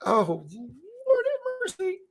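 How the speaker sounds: very high, slow, drawn-out plea; high slow mock despair; exasperated. Real, not acted. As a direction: wail it up to the heavens. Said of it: "overwhelmed exclamation".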